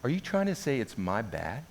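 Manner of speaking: softly puzzled question; imitated careful realisation. Friend realises, acted.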